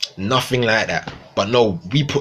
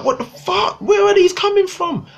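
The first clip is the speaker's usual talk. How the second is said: squeaky high what-the-fuck; incredulous outburst. Retold or imitated. Mocking his own shock at new bars, squealing high. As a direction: voice shoots way up in disbelief. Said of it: "I'm like".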